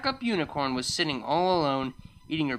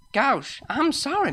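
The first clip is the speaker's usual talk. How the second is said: higher, flustered apology; apologetic; 10 semitones higher, 4 dB louder than usual. Acted. Farmer apologizing to the unicorn, flustered. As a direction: apologize in a high character voice. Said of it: "apologized Applejack".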